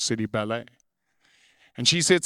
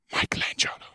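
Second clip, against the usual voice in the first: dread; 3 dB quieter, faster than usual. A narrator's reading. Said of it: "cried God in dread".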